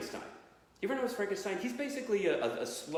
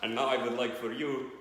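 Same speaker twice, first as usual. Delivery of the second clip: low, hammed-up vampire accent; Dracula; 6 semitones lower than usual. Vampire thanking agents and Mama for an award, low accented voice. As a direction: give an acceptance speech as a comic vampire. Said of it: "did Dracula win an Oscar".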